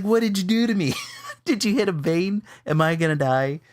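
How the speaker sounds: high, laughing fake terror; what did you do to me, half laughing; laughing mock panic. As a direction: ham up the fear through laughter. Retelling fear, laughing.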